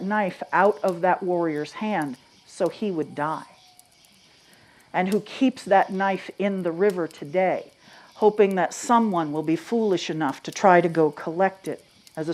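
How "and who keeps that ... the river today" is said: ordinary delivery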